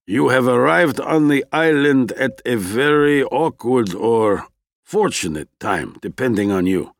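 understated stern pronouncement in character. A narrator's reading. Scientist addresses newcomer about her timing, mildly stern. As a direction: lightly voice a stern, formal expedition man. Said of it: "Addressing Carla in a stern voice".

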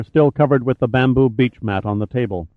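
usual speaking voice

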